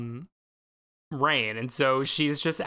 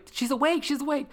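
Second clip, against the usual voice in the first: higher, eager cry of relief; mock-excited hospital relatives; 10 semitones higher, faster than usual. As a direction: gasp out the good news. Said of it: "everyone's like".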